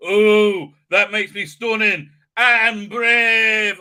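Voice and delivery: loud, high, drawn-out mock boasting; milk it with hammed-up pride; mock self-congratulation. Mocking an acted elf character.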